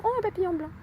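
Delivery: high, soft exclamation of wonder; delighted surprise. Real, not acted. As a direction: get sweetly sidetracked, voice lifting high. Spots a butterfly mid-sentence.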